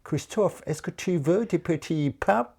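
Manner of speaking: put-on high, slow, charming English accent on French words. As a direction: play the English girl charming him with her accent. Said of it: "elle me dit".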